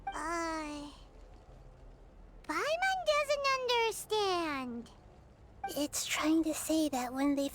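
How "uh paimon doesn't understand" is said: high bubbly little companion; squeaky eager fairy chatter; cheerful tiny floating sidekick; chirpy sidekick voice. Acted.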